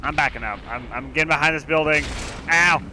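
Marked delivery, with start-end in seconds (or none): 2.5-2.8 alarm shout. Real, not acted. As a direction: blurt it out, startled and stung. Hit by enemy fire in game.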